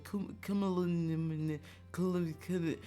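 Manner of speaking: high, halting garble of a long word; imitated stumbling. Imitating people attempting the streamer's name, stumbling.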